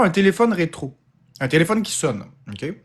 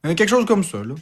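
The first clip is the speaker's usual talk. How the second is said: ordinary talk, nothing marked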